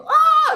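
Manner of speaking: short, high imitated scream; retold scream. Two boys screaming at the fire pit before running, high scream. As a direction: let out a loud, high yelp of mock terror. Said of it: "we scream".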